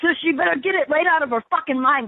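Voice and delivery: usual speaking voice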